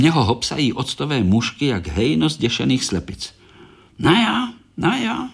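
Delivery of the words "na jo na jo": much higher, exasperated scolding; mock anger. Korálek angry at the fruit flies, high exasperated scolding. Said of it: "rozezlil se Korálek".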